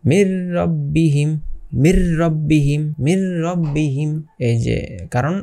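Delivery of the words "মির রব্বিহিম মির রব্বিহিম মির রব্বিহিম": মির রব্বিহিম recited slowly, the নুন merged straight into a doubled র; merged without ghunnah. Shown as a demonstration. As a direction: slowly draw out মির রব্বিহিম with a rolled double র and no nasal hum. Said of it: "আমরা কিভাবে মিলিয়ে পড়বো".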